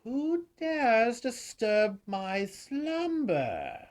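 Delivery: high, slow booming challenge; ancient rasping undead king. Acted. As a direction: boom it out like a waking monarch. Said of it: "And you hear".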